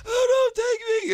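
high, pleading cry; mock terrified plea. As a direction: beg for your life in mock terror. Mom panics at masked man.

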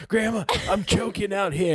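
high, strained mock panic; hammed-up choking plea. Grandson begs grandma for help, hammed-up and high. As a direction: squeeze it out like you can barely breathe. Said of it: "I was like".

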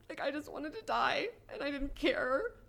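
really crying as she admits it. Real, not acted. Telling friends how she wanted to die, crying.

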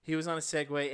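usual speaking voice

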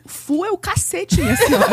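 loud, high mock retort at the clerk; dad's angry retort. Father snapping back at the airline clerk, mock angry. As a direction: fire back at her, half laughing. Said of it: "meu pai virou pra ela".

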